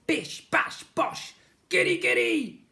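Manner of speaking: high, loud, gleeful blob chant; slime creature voice. Acted. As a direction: chant it mischievously. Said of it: "said Slime".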